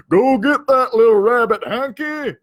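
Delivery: loud, high jeering cowboy call; mocking yell. Cowboy yelling at Hank to tease him, jeering. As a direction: holler it to tease the dog. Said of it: "one of 'em yelled".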